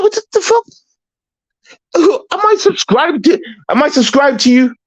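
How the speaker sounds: high-pitched disbelief, voice cracking upward; shocked outrage. Real, not acted. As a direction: squeal it out, stunned and offended. Shocked he's not subscribed.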